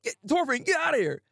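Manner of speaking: high, urging shout to get away; urgent order. Retold or imitated. Alan yells at Thorfinn to leave.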